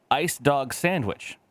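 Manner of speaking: slow, drawn-out product name; mock ad announcer, slow; dramatic reveal. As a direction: announce it like a grand unveiling.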